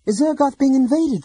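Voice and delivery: high, loud, urgent question; agitated Laurana. A narrator's reading. Laurana presses Theros about the Draconians, high and urgent. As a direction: demand an answer, upset.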